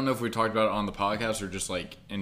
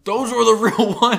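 mock upset yell; 13 semitones higher, 12 dB louder than usual. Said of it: "He goes".

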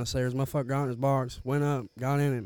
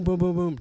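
boom noises; 6 semitones higher, 9 dB louder than usual. Retold or imitated. Mimics box sliding down stairs.

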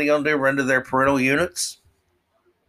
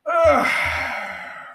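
exasperated sigh; 13 semitones higher than usual. Pretend. Reacting to the absurd guide.

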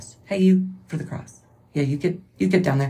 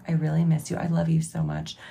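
ordinary talk

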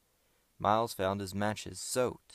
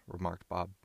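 plain reading voice

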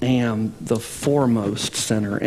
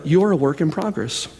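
ordinary delivery

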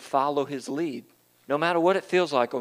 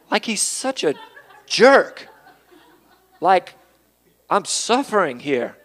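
jerk shouted high and loud; loud outburst; 4 semitones higher, 5 dB louder than usual. Acted. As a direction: fling the word jerk out as a sudden yell. Preacher calls the devil names.

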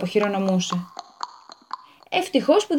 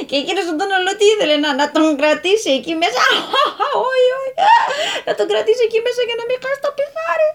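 rising laughter through the words, higher; can barely get it out for laughing; laughing while telling; 10 semitones higher than usual. Real, not acted. Narrator cracking up at the lawyer's story.

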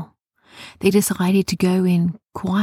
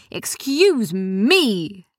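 indignant interruption; 4 semitones higher, much slower than usual. Acted. Sophia bursts in on gnomes.

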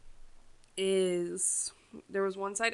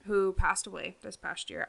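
usual speaking voice

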